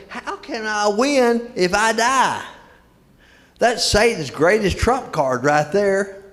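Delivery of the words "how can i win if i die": high, drawn-out question; incredulous protest. Retold or imitated. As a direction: voice a believer's disbelief, slow and high. Voicing a struggling believer's protest.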